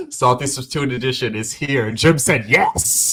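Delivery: grand showman announcing; Doug Dimmadome. Pretend. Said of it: "It's giving Doug Dimmadome".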